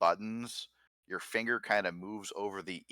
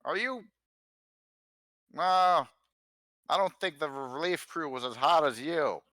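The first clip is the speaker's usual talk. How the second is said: high loud flirty catcall; catcalling construction worker; 8 semitones higher, 6 dB louder than usual. Acted. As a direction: leer at them like a builder. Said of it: "he go".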